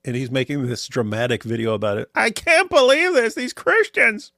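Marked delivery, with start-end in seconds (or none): 2.2-4.3 mock outrage. Mocking the video's dramatic tone.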